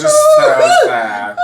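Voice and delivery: high, squeaky laugh breaking through the words; laughing to tears. Real, not acted. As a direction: tell it while cracking up, close to tears. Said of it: "It's like you're tearing up right now".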